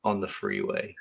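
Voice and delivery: low, soft, dry deadpan comic; flat, quiet monotone, pausing between phrases. Retold or imitated. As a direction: deliver the absurd joke without any inflection. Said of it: "he's, like, really monotone".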